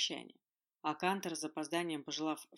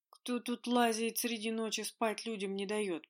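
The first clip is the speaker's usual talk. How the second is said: slow, higher, far-off groan; 5 semitones higher, 4 dB louder, slower than usual. A narrator's reading. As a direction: moan it, woken and grumpy. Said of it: "простонал Жак".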